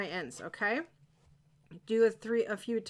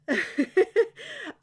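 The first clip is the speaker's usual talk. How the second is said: embarrassed laugh; 10 semitones higher, 6 dB louder than usual. Real, not acted. Embarrassed about talking fast.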